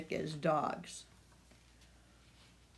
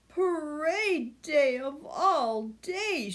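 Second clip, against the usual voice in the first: slow, higher scolding grandma; 8 semitones higher, slower than usual. A narrator's reading. Grandma scolding about parade day, slow and scolding. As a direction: scold it slowly. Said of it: "she scolded".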